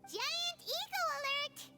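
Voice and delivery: high-pitched, excited alert call. Acted. Companion sounding an alert about Ito, excited and high. Mock alarm over Ito's ego.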